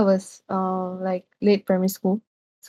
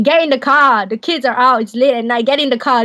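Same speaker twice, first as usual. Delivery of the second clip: mock yell, pitched higher; retold urgent scream; 4 semitones higher, 4 dB louder than usual. Man hurrying a family into the car late at night, mock screaming. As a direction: imitate someone urgently hollering, without full volume. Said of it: "He was, like, screaming".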